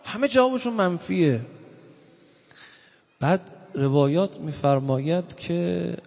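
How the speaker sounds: usual speaking voice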